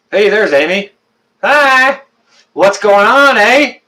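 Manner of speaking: high, hammed-up Canadian "eh" greeting; exaggerated Canadian accent. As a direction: lay the Canadian accent on thick to tease Amy. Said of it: "She always bugs me about my accent".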